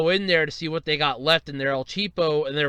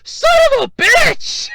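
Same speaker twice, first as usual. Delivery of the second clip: even higher, louder yell; furious shout; 10 dB louder, slower than usual. Retold or imitated. Retelling the outburst about the safe, furious shout. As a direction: let it rip at full volume.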